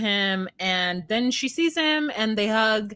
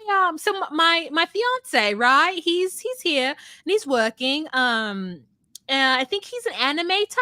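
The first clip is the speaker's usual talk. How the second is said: imitating her; 7 semitones higher than usual. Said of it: "She's like".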